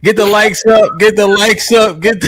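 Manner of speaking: hype call. Real, not acted. Hyping chat for likes.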